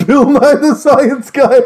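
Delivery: rising, slow, triumphant announcement; grand reveal. Acted. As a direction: build up to the big reveal. Dramatic reveal line.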